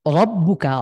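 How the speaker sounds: রব্বুকা with a heavy, full র; thick র. Shown as a demonstration. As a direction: fill the mouth on the র of রব্বুকা. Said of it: "রকে একটু মোটা করে পড়া".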